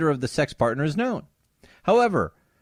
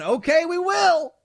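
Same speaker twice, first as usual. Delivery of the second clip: much higher, slow mocking yell; retold yell; 13 semitones higher, slower than usual. Beto supporter heckling Cruz at the rally, mocking high yell. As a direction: shout the heckler's dare. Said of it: "Beto O'Rourke supporter going".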